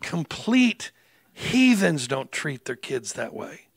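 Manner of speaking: slow, weighted words; slow verdict. Real, not acted. Pastor condemning the parents, slow and deliberate. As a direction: let each word land.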